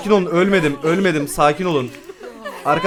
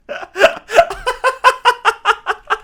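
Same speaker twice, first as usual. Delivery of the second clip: high shrieking laugh; 4 dB louder than usual. Real, not acted. Gamer cracks up.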